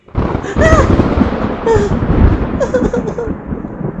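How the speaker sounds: high breathy loud wail; long high baby cry; baby whimper-wail. Acted. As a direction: whine like an upset baby. Baby starts crying again.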